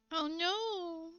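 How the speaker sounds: high, drawn-out mock dismay; mock pity. Shown as a demonstration. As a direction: coo it with exaggerated pity. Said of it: "poking fun at other, uh, phrases".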